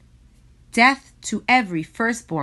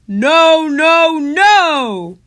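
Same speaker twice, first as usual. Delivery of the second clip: high, loud villain shout; 10 semitones higher, 12 dB louder, much slower than usual. A narrator's reading. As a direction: bellow a stubborn refusal. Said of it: "And Paro shouted".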